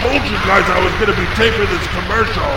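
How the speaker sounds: high, fast, loud telling-off; angry scolding. Acted. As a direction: scold them for ruining the take. Scolding demons mid-commercial.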